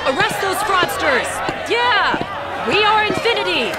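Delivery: pretend protest shout. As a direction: shout along to blend in with the mob. Joining fake protest chant.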